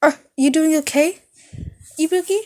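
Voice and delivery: high, fast timid offer; quick high shy question; timid nurse. Acted. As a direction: rush the offer out nervously.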